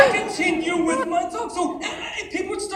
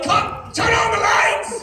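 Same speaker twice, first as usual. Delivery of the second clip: loud, higher shouted order; raised-voice command from the stage; calling out; 6 semitones higher, 4 dB louder, slower than usual. Retold or imitated. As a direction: halt the show and yell to the crew. Comic halts show, calls to crew.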